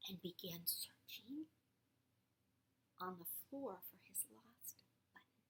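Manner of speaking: whisper. A narrator's reading. Night store narration.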